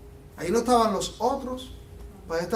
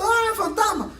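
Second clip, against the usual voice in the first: a playful retold scream of fear, much higher; mock frightened cry; 11 semitones higher than usual. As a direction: imitate the disciples yelping at a ghost. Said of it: "todo el mundo ahí gritando".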